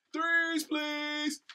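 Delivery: high, loud hype announcer call; sports announcer call. Retold or imitated. Announcer call from his college days.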